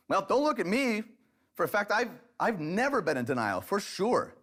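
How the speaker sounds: defensive wife. Pretend. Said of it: "she might reply with".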